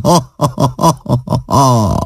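bright ha-ha laughter. A narrator's reading. Wife laughing till she cries, ha ha laughter. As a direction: laugh till tears come. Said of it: "úgy kaszagott, hogy a könnye is".

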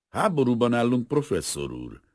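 high, loud opening, then settling; mildly reprimanding. A narrator's reading. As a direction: put him gently but firmly in his place. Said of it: "kicsit rendre utasítóan". (kicsit rendre utasítóan)

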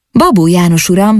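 ordinary delivery, nothing marked

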